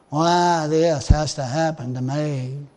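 whiner. Retold or imitated. A churchgoer whining about his troubles, slow and low. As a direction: play the self-pitying whiner. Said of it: "I'm a whiner".

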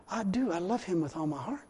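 very soft, retold inward admission; hushed realization. Believer realizes he loves God, hushed realization. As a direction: say it quietly, as a thought to yourself. Retelling his sudden private realization.

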